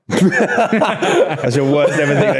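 laughing. Real, not acted. Incredulous laughing reaction.

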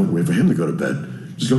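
ordinary talk

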